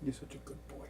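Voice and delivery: soft pet praise; whispering to a cat. Real, not acted. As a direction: hush it to the cat.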